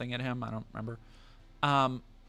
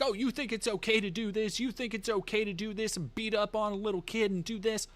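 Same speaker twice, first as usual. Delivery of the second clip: retold angry yelling; 6 semitones higher than usual. Imitating the group confronting a man in the park, mock yelling. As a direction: imitate an accusing crowd, pitched up, volume held back. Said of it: "yelling at him".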